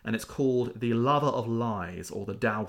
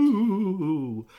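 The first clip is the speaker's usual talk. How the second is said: ghost woo; 8 semitones higher, 5 dB louder than usual. Retold or imitated. Ghost appears in retold story.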